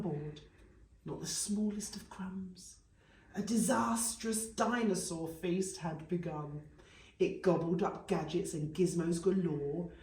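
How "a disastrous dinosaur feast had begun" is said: ordinary delivery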